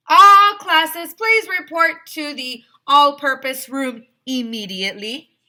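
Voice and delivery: high, loud PA voice; loudspeaker announcement. Acted. School loudspeaker calling all classes, loud. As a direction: boom it out over the intercom.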